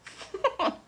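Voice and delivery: quick high laugh; a real burst of laughter at herself; short laugh. Real, not acted. Laughing to the viewer at her own reply, high laugh.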